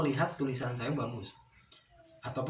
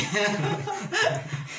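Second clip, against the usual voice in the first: hearty laugh at a joke; amused laugh; 12 semitones higher than usual. Real, not acted.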